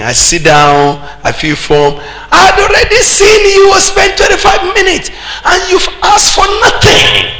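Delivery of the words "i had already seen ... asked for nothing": high-pitched exasperated outburst; exasperated shout. Retold or imitated. Preacher recalling a visitor who wasted his minutes, exasperated shout. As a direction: cry out at the wasted time, voice soaring.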